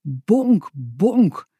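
ordinary delivery, nothing marked

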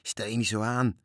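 ordinary delivery, nothing marked

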